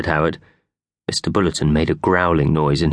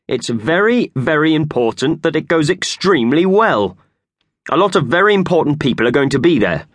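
a self-important boss, voiced a bit higher; pompous boss voice; 8 semitones higher, 4 dB louder than usual. A narrator's reading. Boss lecturing Howard about the conference, lightly voiced pompous.

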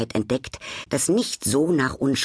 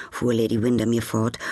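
ordinary delivery

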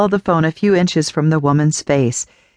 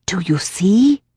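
put-on eerie old woman whisper; 3 semitones higher than usual. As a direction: breathe the question like a secret.

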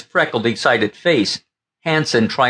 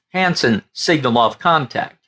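usual speaking voice

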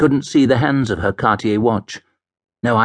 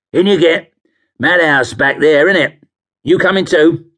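higher-pitched, chirpy cabbie voice; 6 semitones higher, 5 dB louder than usual. Acted. Cabbie greets drunk fare, breezy. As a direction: pipe up as a London cabbie.

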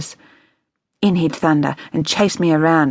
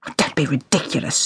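snorting scorn; 4 dB quieter, faster than usual. A narrator's reading. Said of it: "she snorted".

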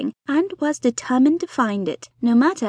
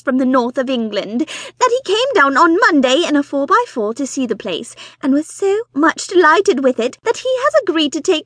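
high, loud, breathless society wife; fussy excited mother; 5 semitones higher, 6 dB louder than usual. Acted. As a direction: gush it out like an eager matchmaking mother. Mrs Bonnet excitedly reporting news.

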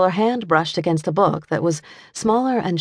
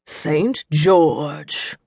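authoritative near-whisper. A narrator's reading. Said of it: "a voice that rang with authority, though it was no more than a whisper".

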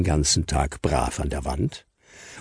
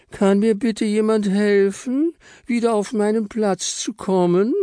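squeaky Frau Stern; 14 semitones higher than usual. Acted. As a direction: plead sweetly in a tiny high voice. Frau Stern asks for help.

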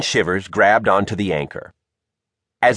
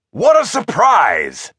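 slow, raised, hearty exclamation; gruff, grinning dad; 9 semitones higher, 4 dB louder, slower than usual. A narrator's reading. Bob greets Shivers from the deck, gruff and delighted. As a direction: boom it out through a grin. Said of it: "Bob grinned through his bristly beard".

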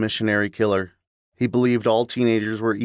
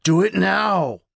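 evil command; 10 semitones higher, slower than usual. Acted.

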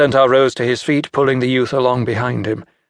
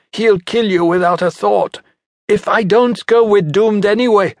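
urgent, pitched well up; young hunter arguing, high and urgent; desperate son; 8 semitones higher than usual. Acted. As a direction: plead with mother, voice high. Son argues with mother.

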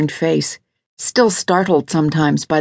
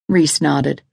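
plain reading voice

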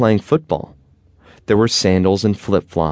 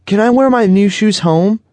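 very high, loud hopeful question; eager boy; 13 semitones higher, 6 dB louder than usual. A narrator's reading. Boy asking his dad to wear new shoes home, loud high eager. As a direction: ask it like a thrilled kid. Eager child asks father.

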